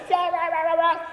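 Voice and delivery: squeaky high gibberish nagging; mocking a girlfriend who keeps arguing, high and yappy. Girlfriend keeps arguing, mocked in a squeaky high voice. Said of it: "she said".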